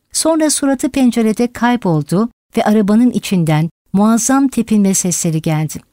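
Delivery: ordinary delivery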